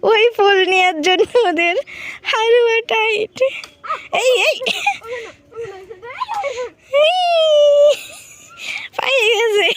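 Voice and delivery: high, loud excited egging on; excited cheering. Real, not acted. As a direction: cheer them on, thrilled. Cheering the kids' flower hunt. (উত্তেজিত চিৎকার করে উৎসাহ দেওয়া)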